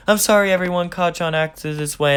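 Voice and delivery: a hint of a nervous people-pleaser; apologetic Deku voice. Deku apologizes to the class for Kacchan's behavior, high and eager.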